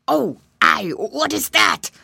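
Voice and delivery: loud, startled alligator voice; alarmed cry. A narrator's reading. Alligator startled by loud bangs, alarmed cry. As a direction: cry out at a sudden scary noise. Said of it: "cried the gator".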